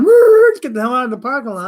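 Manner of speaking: loud high whoop, then a fast rush; squealing getaway. Retold or imitated. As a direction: whoop like you're fleeing with loot. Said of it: "We're basically squealing".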